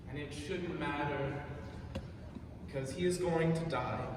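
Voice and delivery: ordinary delivery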